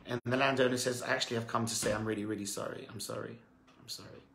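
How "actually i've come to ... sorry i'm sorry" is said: quick apology that fades to almost nothing; humble apology fading out. Acted. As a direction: let the sorry die away softly.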